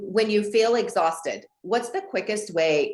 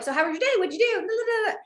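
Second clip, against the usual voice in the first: much higher, eager rush of questions; eager chatty greeting; faster than usual. Retold or imitated. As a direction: bounce up in pitch like you're dying to chat. Quoting herself greeting boyfriend.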